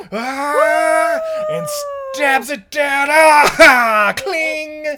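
high, loud battle cry; battle yell. Acted. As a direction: shout like striking a sword. Acting out sword strike.

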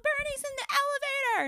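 high child yell, mocked. Mom imitating her oldest daughter yelling, high mock child yell. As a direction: squeal it like a panicked little girl. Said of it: "yells at me".

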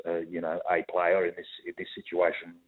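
ordinary talk, nothing marked

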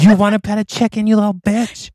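put-on high, sneering little-girl voice; evil child taunt. North mocking the electrocuted sister: high sneer. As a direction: taunt them like a tiny tyrant.

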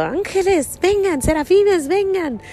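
put-on high child's voice calling the angels; a mother voicing her son, high-pitched and pleading. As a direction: call up to the angels like an eager little boy.

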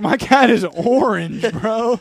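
high, loud and slow, mock outraged; mock indignant. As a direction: complain like a wronged cat owner. Retold reply to grandpa.

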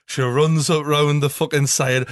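ordinary delivery

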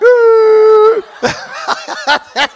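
high, drawn-out mock gasp for air; winded gasp. Winded boxer trying to breathe for the medic, hammed-up gasp. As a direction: strain to suck in a breath. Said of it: "Try again".

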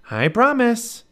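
put-on high, dutiful young girl; little girl voice. A narrator's reading. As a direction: promise in a high girl's voice. Narrator voices Annie.

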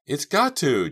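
high, loud protest; desperate cry. A narrator's reading. Trapped girl refusing to accept failure, crying out. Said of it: "Jade cried".

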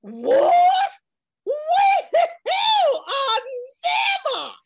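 shrieked high and loud in shock; outraged gasp of disbelief; shocked shout. Acted. Kitty outraged by scheme.

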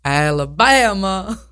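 slow, drawn-out movie impression; Forrest Gump. Co-host echoing the host, Forrest Gump-style drawl. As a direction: stretch every syllable of the state name. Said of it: "Forrest Gump".

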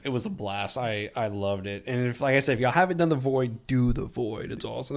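usual speaking voice

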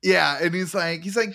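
ordinary talk, nothing marked

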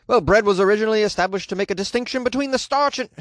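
rushing a shaky Irish impression; bad Irish accent. Quoting the Irish judge in a failed Irish accent. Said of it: "I can't do an Irish accent".

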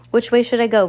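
urgent question called out; calling out. A narrator's reading. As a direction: call across to someone at a distance. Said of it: "Violet called from the controls".

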